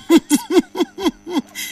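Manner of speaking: short amused laugh. Real, not acted. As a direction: chuckle at the excited caller. Host laughs at caller.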